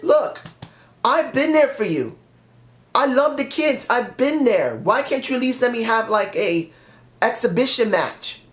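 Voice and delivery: upset plea. Retold or imitated. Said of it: "John generally gets upset about that".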